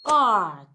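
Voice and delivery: "art" with a stressed open "ah"; loud, full vowel in "art"; long vowel. Shown as a demonstration. Teacher reads third example word, long stressed vowel. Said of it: "this particular sound, sound occurs in words like".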